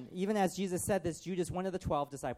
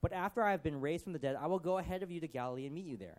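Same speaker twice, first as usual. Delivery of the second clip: ordinary delivery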